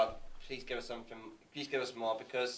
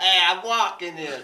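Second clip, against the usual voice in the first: put-on Brooklyn accent; 6 dB louder than usual. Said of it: "speaking in a Brooklyn accent".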